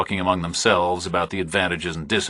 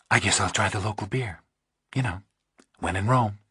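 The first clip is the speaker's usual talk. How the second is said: lightly voiced woman; 2 semitones higher, 5 dB quieter than usual. Narrator voices Pauling.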